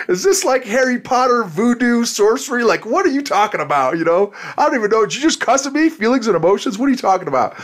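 mock-bewildered, high and shocked; baffled disbelief. Guest retelling counseling, in a high mock-baffled voice. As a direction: play a man stunned by the idea of feelings. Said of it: "And I was like".

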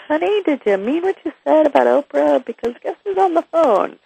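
high, put-on sing-out to a spouse; playful retold plea. Wife retelling her question to her husband, high and playful. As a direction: lift it well up, mock-innocent.

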